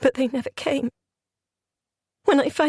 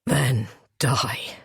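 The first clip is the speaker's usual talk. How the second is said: strained gasp; 9 semitones lower than usual. Acted. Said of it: "he managed".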